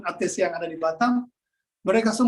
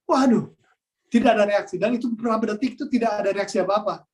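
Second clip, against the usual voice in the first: usual speaking voice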